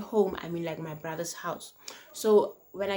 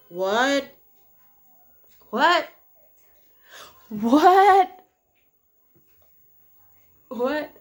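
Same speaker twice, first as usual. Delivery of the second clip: shocked yelling; 6 semitones higher, 8 dB louder, much slower than usual. Retold or imitated. Shocked reaction to his confession.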